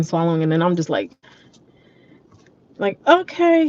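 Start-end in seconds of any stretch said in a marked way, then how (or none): 3.1-3.7 much higher, drawn-out retold okay; high-pitched okay. Patient answering the technician, high-pitched okay.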